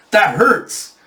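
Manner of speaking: high-pitched mock scolding; indignant complaint. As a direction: complain sharply about the pain. Woman objects after backhanding him.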